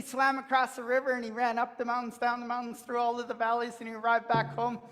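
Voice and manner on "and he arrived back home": ordinary delivery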